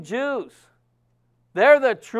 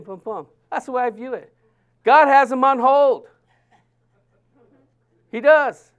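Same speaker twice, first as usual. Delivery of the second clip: ordinary talk, nothing marked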